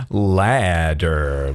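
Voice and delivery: "Ladder" said low and stretched; low, slow "Ladder"; low drawn-out word. Pretend. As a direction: draw out "Ladder" in a low pitch. Silly repeated word while crafting.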